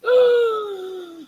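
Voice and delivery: loud high gasp of horror; shocked gasp. Retold or imitated. Mother sees the shaved child, loud horrified gasp. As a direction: gasp as if seeing disaster.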